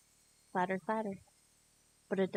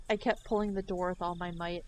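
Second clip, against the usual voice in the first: plain reading voice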